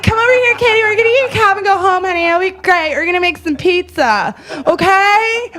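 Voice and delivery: high-pitched, urgent pleading; imitated placating holler; coaxing call. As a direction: coax a crying friend from across the street. Coaxing crying friend from across street.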